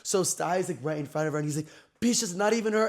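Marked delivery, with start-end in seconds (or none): 2.0-2.9 high-pitched imitated irritation; irritated. Sty complaining right in front of the girl, irritated. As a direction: act out a peeved friend's complaint. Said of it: "He was so irritated".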